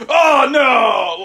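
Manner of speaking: cartoonish cry of alarm; mock dramatic shout. Imhotep arriving late on set, mock dramatic shout.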